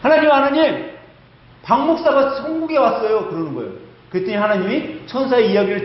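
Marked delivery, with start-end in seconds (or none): 0.0-3.3 flushed, excited angel. Acted. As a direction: burst in with big news, out of breath. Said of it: "상기된 목소리로". (상기된 목소리로 다급하게 외치는 천사)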